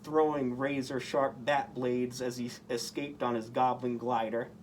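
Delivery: plain reading voice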